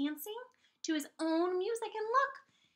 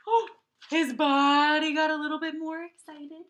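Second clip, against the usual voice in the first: excited; 10 dB louder than usual. Acted. Teacher acts out Gerald's excitement.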